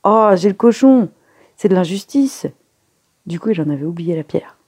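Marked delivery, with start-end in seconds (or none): 0.0-2.5 loud, high-pitched protest; indignant howl. A narrator's reading. Colline protesting at getting the pig, indignant howl. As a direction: wail at the injustice, acted. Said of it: "poussa un hurlement indigné".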